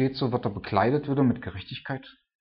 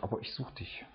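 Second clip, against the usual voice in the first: breathy whisper, almost no voice; a hushed, voiceless prayer; whispered plea; 13 dB quieter than usual. Acted. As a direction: plead under your breath to God. Desperate prayer voiced to God.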